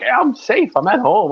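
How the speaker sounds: high-pitched breezy self-justification; imitating someone who sees no problem; mock carefree husband. Voicing husband's carefree reasoning.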